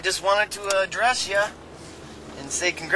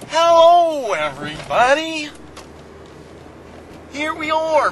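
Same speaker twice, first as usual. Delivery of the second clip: excited greeting; 6 semitones higher, 4 dB louder than usual. Real, not acted. Vlog greeting to viewers.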